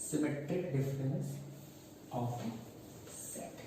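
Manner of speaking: each word drawn out very slowly for note-taking; slow dictation. Shown as a demonstration. Teacher slowly dictating the topic title to students. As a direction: spell it out slowly so students can write it down. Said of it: "क्या पढ़ेंगे?".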